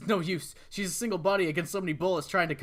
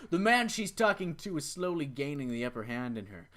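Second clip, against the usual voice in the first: normal talking voice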